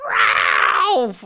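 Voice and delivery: high mock scream of a name; acted-out high yell; calling out. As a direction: cry out the name in alarm, hammed-up. Acting Megan Fox crying out.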